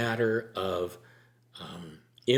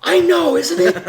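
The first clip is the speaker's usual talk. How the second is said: much higher and louder, laughing through the words; laughing reply; 14 dB louder than usual. Retold or imitated. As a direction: let the laugh carry God's playful answer.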